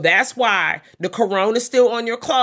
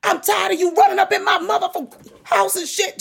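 much higher, loud screaming rant; furious shout; 14 semitones higher, 5 dB louder than usual. Pretend. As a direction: scream them out the house. Imagined tongue lashing of Pedro.

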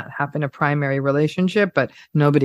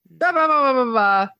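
mock bored blather; 8 semitones higher than usual. Mocking a diet list.

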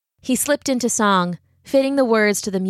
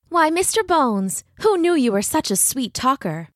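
high, teasing belle's voice; 6 semitones higher than usual. Acted. Katrina answers Brahm's compliment, high flirtatious teasing.

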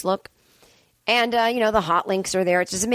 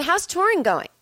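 friendly question; 10 semitones higher than usual. Retold or imitated. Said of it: "Can't they say".

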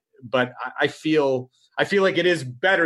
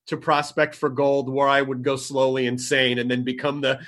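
ordinary talk

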